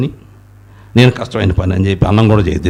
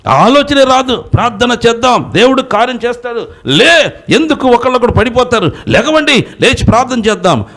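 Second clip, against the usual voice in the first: fiery preaching. Acted. Preacher rebuking the congregation for weak faith, fiery preaching. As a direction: preach it hard and rising to the crowd.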